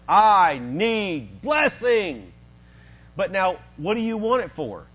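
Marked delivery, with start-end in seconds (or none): 0.1-2.3 very slow, drawn-out emphatic plea; crying out to God. Acted. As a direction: cry it out, stretching each word. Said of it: "he is crying out to God".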